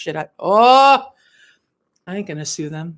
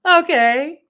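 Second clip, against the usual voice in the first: usual speaking voice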